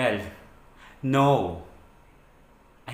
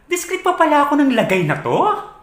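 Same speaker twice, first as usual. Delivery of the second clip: high, loud incredulous question; raised, offended protest; indignant outburst; 12 semitones higher, 5 dB louder than usual. Acted. Protesting partner's demand to be discreet, indignantly.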